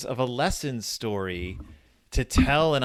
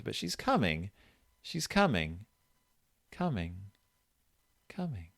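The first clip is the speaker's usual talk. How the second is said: fading to whisper; 4 semitones lower, 9 dB quieter than usual. Acted. Fading eerie repetition.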